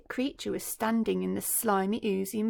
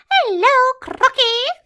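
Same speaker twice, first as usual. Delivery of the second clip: much higher, louder cheeky greeting; chirpy monkey; 14 dB louder, slower than usual. Acted. Mugglewump greeting the crocodile, high and chirpy.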